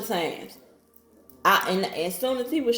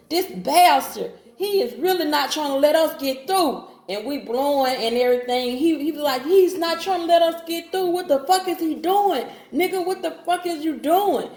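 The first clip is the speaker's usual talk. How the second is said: angry yelling; 7 semitones higher, 3 dB louder than usual. Retold or imitated. Boyfriend yelling at blocking car.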